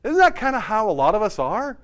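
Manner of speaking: ordinary talk, nothing marked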